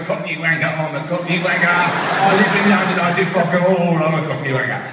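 loud, jeering Cockney caricature; mock Cockney accent. Immigrant silently answering London mockers, jeering mock Cockney. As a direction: taunt back in a hammed-up Cockney accent. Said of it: "not out loud, of course, inside. I was going".

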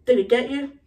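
usual speaking voice

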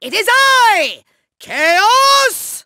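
high, slow, drawn-out proclamation; grand villain entrance. Acted. Villain announcing himself, grandly bombastic. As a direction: announce yourself with huge pomp.

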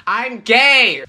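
full loud shout of joy. Pretend. Vlogger shouting his news to the house, loud. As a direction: belt it out to the whole house. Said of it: "maybe I should shout it out".

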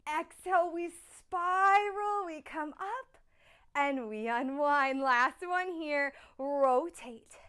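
usual speaking voice

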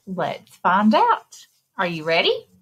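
ordinary delivery, nothing marked

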